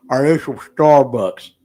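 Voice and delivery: slow, drawn-out wife voice; mimicking the wife. Husband mimics wife asking about Starbucks, slow and drawn out. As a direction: drag the question out like his wife. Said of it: "she goes".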